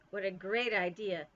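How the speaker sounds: usual speaking voice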